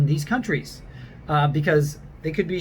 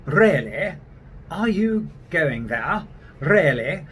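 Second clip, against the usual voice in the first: British accent with "Really" rolled on the R; British English, rolled R's on Really; slower than usual. Shown as a demonstration. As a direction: trill the R in "Really" like a Brit. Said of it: "they will roll their R's".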